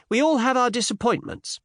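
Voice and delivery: high, mock-solemn young lady; sententious. A narrator's reading. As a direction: pronounce it like a maxim, high-pitched. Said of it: "said Miss Cinderella sententiously".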